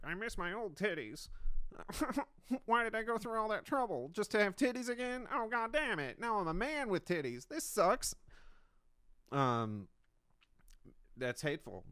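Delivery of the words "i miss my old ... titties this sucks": high, hammed-up whiny lament; a put-on high voice, laughing through the complaint. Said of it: "and he's like".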